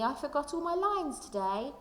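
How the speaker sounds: ordinary delivery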